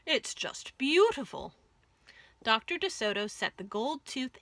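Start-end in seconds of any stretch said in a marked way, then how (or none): 0.0-1.5 a hint of a delighted fox; lightly voiced fox. A narrator's reading. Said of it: "exclaimed the fox".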